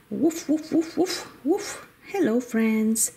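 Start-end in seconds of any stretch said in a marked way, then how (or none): 0.1-1.9 high yappy barking for the toy dogs; playful dog barks. Pretend. Voicing toy puppies for the viewers, high playful barks. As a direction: bark like little puppies, high and bright.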